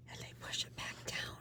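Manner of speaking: very soft, voiceless whisper; strained whisper. Acted. Therapist shows clients swallowing their feelings, in a strained whisper. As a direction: squeeze the words down to almost nothing. Acting out suppressing the tears.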